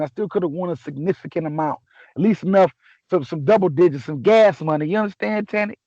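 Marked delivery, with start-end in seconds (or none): none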